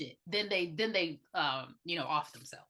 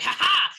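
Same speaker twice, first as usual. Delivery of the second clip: mock triumphant laugh; 7 semitones higher, 8 dB louder than usual. Rose laughs stabbing.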